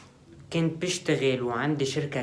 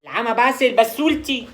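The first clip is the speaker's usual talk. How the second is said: exasperated outburst; 8 semitones higher, 8 dB louder than usual. Acted. Caught gambling, exasperated outburst.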